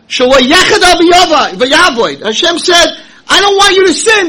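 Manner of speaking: high, loud fiery preaching; preaching at full force. Acted. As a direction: preach it with full fire to the crowd. Rabbi preaching on Rashi.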